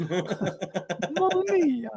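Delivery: high, very slow laughing mock Italian; laughing hammed-up Italian Mamma Mia; mock Italian accent. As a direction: ham up an Italian exclamation. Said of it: "how do you sound Italian".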